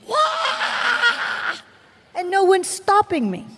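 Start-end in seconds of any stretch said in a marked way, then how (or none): 0.1-1.6 high mock wailing scream; imitated wail. Preacher reenacting her screaming at the revival, high wail. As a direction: wail it out, half laughing. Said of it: "I am stuck there screaming".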